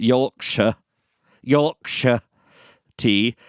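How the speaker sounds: hammed-up British accent, punched out; mock British accent. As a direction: give in and put on a playful British accent. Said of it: "I'm not gonna do the accent".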